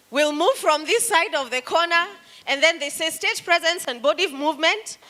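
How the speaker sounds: big stage projection demo; projecting demo. Shown as a demonstration. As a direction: project to the whole room while moving the stage. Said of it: "we have something called stage movement".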